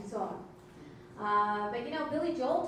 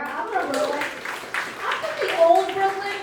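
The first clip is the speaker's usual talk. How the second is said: loud, raised-pitch hometown boast; proud shout; 7 semitones higher, 12 dB louder than usual. Real, not acted. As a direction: shout it out high to the crowd.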